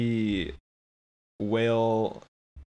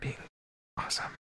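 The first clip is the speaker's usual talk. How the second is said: hushed whisper, kept under the breath; whispered aside; 12 dB quieter, much faster than usual. Pretend. Casting panel member confides in Kevin about the actor, whispered aside. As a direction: whisper so the auditioner won't hear.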